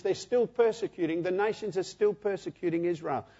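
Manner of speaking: ordinary delivery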